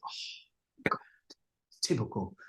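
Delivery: soft, mostly voiceless imitated sigh; exasperated aside. Singer hearing it is Clive's order, exasperated aside. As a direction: sigh it out very softly, as if put out. Retold weary reaction to the boss.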